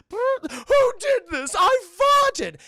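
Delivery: high, loud, slow wail; mock British dismay. Pranked girl replayed on tape, high loud mock wail. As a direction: wail it in hammed-up outrage.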